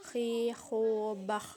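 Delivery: "Khi, khu" drawn out with a throat rasp; snore-like kh. Shown as a demonstration. Teacher shows kho, "Khi, khu, bah" rasped like snoring. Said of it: "seperti suara orang yang sedang mendengkur".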